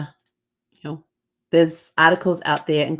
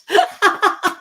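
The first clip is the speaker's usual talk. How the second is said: squealing laugh, high; high burst of laughter; 5 dB louder than usual. Real, not acted. Amused by the guest's compliment, squealing laugh. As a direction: laugh out loud at the punchline.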